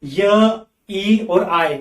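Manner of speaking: य, ई and आई drawn out very slowly; slow teacher demo of the three वाई sounds; sounds spelled out. Shown as a demonstration. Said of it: "ये तीनों sound किसके लिए आते हैं".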